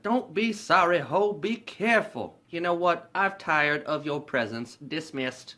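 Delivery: high, haughty pimp imitation; Money Mike. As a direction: dismiss her in a drawn-out pimp voice. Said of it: "Money Mike is talking to Donna".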